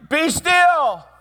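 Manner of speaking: loud, high, commanding order to the storm; commanding call. Acted. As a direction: bark it out with full authority.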